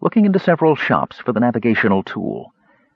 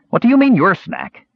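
high, indignant demand; incredulous challenge; 11 semitones higher, 4 dB louder than usual. A narrator's reading. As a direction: challenge the claim in disbelief. Warrior disputes whose snack it is.

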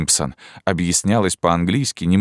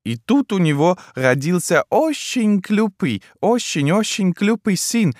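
put-on high, slow, broken-Russian old Frenchwoman; a bewildered elderly French wife, high and slow; old Frenchwoman puzzled by her husband; old French lady; 9 semitones higher than usual. Old French lady's lines.

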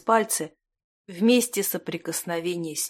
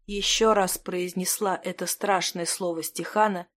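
ordinary delivery, nothing marked

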